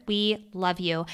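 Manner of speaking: soft, low affectionate thanks; tender. Real, not acted. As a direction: say it gently. Affectionate sign-off.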